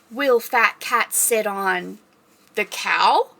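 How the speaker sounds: slow, drawn-out read-aloud question; reading to children. Pretend. Librarian reading picture book to kids, slow suspenseful question.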